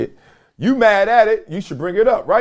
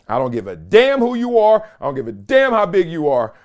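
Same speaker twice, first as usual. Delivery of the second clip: ordinary delivery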